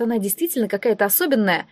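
usual speaking voice

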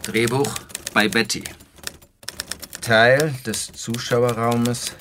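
langsam und getragen vorgelesen; Drehbuch vorgelesen. Acted. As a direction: lies die Szenenanweisung gedehnt vor. Liest Drehbuch-Szene vor. (Autor liest seinem Publikum das Drehbuch langsam vor)

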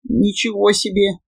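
high, soft squeak of horror; terrified gremlin. A narrator's reading. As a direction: squeeze it out in terror. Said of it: "в ужасе выдавил из себя гремлин".

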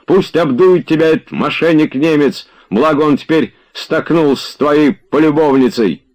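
high-pitched, scornful bawling; angry yelling. A narrator's reading. Tarantyev curses Oblomov and the German, yelling. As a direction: hurl the insults at full pitch. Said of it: "вопил Тарантьев".

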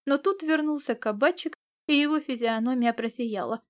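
usual speaking voice

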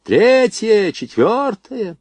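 high, drawn-out firing call; stretched, high-pitched shout; taking up the yell. A narrator's reading. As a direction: bellow the next guns in turn. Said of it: "подхватил Жиганов".

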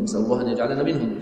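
ordinary delivery

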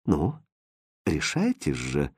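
soft, low coaxing; insinuating. A narrator's reading. Hrustald urges the princess to decide, softly insinuating. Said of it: "вкратчиво произнёс Хрустальд".

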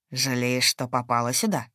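low, narrowed menace; low looming threat. A narrator's reading. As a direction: taunt her, low and cold. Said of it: "прищурился он".

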